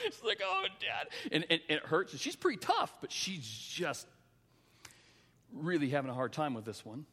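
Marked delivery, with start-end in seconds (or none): 0.4-1.1 very high mock-tearful whine; crying child. Hurt daughter calls to her dad, imitated crying. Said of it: "She's crying".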